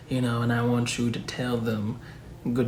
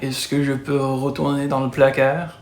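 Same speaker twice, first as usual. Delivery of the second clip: French skit voice; 3 semitones higher, 3 dB louder than usual. Acted. Skit Frenchman asks to leave.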